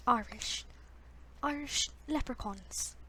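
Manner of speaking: slow, drawn-out attempt at an Irish accent; trying out Irish. Shown as a demonstration. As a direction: test a stage-Irish lilt. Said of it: "It's supposed to be Irish".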